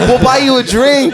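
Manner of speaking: high, loud mock pleading; buy peace in a hurry, imitated; frantic appeasing. Friend placating a man with a knife, mock frantic.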